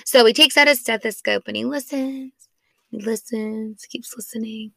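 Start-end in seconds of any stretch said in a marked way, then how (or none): 2.9-4.7 very soft, voiceless narration of a tense wait; hushed, as if not to disturb the listening; hushed storytelling. Retold or imitated. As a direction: drop to a near whisper. Acting out doctor's long silent listening.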